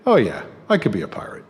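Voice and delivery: ordinary talk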